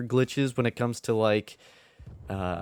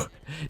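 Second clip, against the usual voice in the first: quick embarrassed chuckle; a brief laugh at himself; short laugh; 7 semitones higher, 9 dB quieter than usual. Real, not acted. Laughs at forgetting to record.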